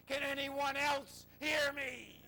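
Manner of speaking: high, slow, drawn-out comic call; crying out. Retold or imitated. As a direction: call out for a second opinion from the mountainside. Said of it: "thinks for a moment and cries".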